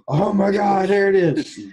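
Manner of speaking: high, loud panicked cry; spooked by a noise in the dark; frightened. Retold or imitated. Frightened in the woods.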